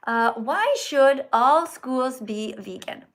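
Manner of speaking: slow, deliberate read-aloud. Acted. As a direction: read it slowly for a child. Reading question aloud to child.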